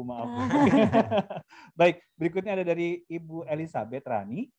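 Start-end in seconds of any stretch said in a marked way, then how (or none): 0.3-1.4 loud, high laughing burst; amused outburst of laughter; real laugh. Real, not acted. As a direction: keep laughing after an apology. Host laughs after apologizing.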